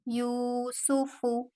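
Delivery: यूसुफु with यू held for two seconds and सुफु said quickly; long yu, quick su fu. Shown as a demonstration. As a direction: stretch the first vowel of यूसुफु. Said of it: "टू second के लिए extend किया". (यूसुफु में यू को दो second खींचें, सुफु जल्दी)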